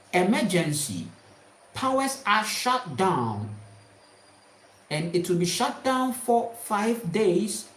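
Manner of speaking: ordinary talk, nothing marked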